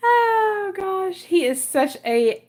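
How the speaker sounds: squealing high oh gosh; overwhelmed by cuteness. Real, not acted. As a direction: squeal with delight.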